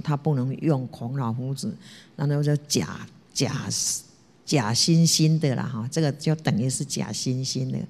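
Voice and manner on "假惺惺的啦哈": usual speaking voice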